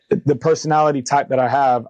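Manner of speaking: usual speaking voice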